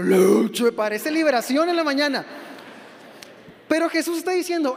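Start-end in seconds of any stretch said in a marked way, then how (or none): 0.0-0.7 low, loud bellowed leche; booming shout. Retold or imitated. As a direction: bellow leche like the little one. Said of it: "y el otro". (grito grave y fuerte de leche)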